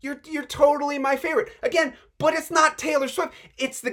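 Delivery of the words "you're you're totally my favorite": high, loud, fast gushing praise; gushing star. Pretend. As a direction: gush over your biggest fan. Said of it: "she knows your name and she's like".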